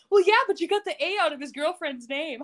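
high, playful retort with laughter; tease, on the edge of laughing; flirty teasing. Retold or imitated. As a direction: imitate a flirty, amused comeback. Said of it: "they're flirting and laughing".

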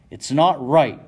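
loud, high furious yell; a merchant bellowing at the king's taxes; angry yell. Acted. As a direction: shout it at the room, outraged. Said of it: "Shea yells".

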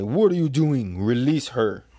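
acted booming intervention; commanding voice. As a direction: cut in with a forceful demand. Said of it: "we hear a voice say".

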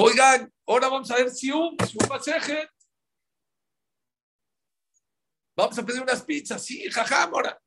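high, eager call to the class; rally the kids in a high-pitched voice; acted excited announcement. Rabbi offering pupils pizza, excited high announcement.

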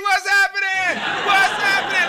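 excited greeting. Real, not acted. Greeting his aunt on the livestream, excited shout. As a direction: call out to her like a party guest.

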